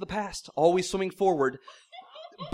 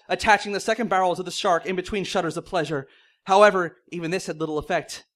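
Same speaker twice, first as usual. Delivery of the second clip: usual speaking voice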